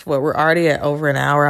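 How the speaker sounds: usual speaking voice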